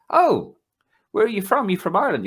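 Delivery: high, loud imitation of an eager old Italian man; Roberto voice. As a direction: do the friendly stranger who won't leave you alone, pitched well up.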